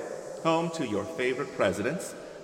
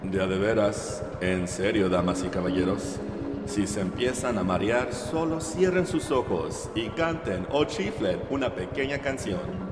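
lower, booming Spanish announcer; lucha libre announcer; 5 semitones lower than usual. Acted. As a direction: announce it like a wrestling MC.

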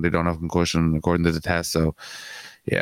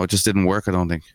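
ordinary talk, nothing marked

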